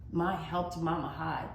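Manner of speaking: usual speaking voice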